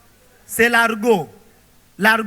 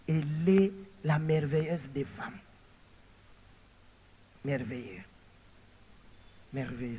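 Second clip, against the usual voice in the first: much softer, lower, savouring merveilleux; soft admiring murmur; 6 semitones lower, 12 dB quieter than usual. Acted. Preacher praising a godly woman to the congregation, hushed. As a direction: breathe the word in quiet admiration.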